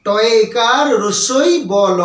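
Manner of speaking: রসই and বল stretched, letter by letter; slow spelling-out. Shown as a demonstration. Teacher repeats the correct spelling for students, slowly stretching টয়কার রসই বল. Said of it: "এর সঠিক উচ্চারণ".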